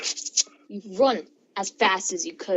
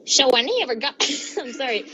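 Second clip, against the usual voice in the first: ordinary delivery, nothing marked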